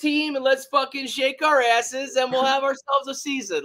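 high and loud, riding the excitement; giddy high rally yell; hyped-up shout. Real, not acted. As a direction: whoop it up like a party. Hyped dance-team joke.

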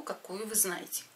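normal talking voice

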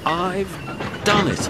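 high, halting gasp bursting out at the end. A narrator's reading. Exhausted engine just reaching the station, halting high gasp. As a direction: break it with pauses, then push out the finish. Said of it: "he gasped between the clanks".

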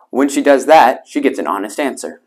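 ordinary delivery

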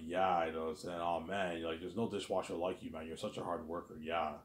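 ordinary talk

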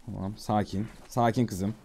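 soft, low calming voice; soothing. Pretend. Player calming a spooked horse, soft and soothing. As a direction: gently settle a nervous animal.

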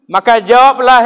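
ordinary talk

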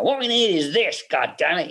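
a hint of a gruff studio boss barking; barking producer. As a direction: fire off an impatient order like the old-school boss. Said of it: "a barking kind of".

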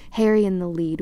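ordinary delivery, nothing marked

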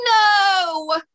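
sharp high scream of despair. A narrator's reading. Fairy screaming as the magic door vanishes. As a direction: cry it out, high and loud. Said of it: "she screamed".

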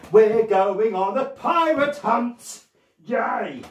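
loud, slow, high rallying cheer; excited announcement. Pretend. Pirate entertainer announcing a pirate hunt to children, excited cheer. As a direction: stretch it out as a big announcement to children.

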